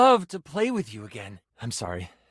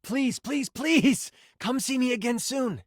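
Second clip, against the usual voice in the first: higher-pitched, excited begging; eager pleading; 7 semitones higher than usual. Acted. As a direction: beg them eagerly to come back. Begging player to return.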